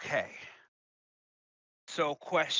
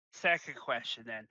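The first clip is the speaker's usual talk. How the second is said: ordinary talk, nothing marked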